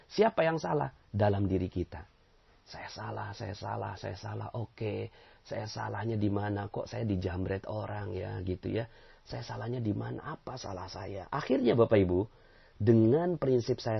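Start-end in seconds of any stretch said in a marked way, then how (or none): 2.6-11.3 soft, low talking to oneself; hushed, humble self-questioning; self-examining murmur. Acted. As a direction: quietly accept blame and wonder why. Acts out humble introspection after robbery.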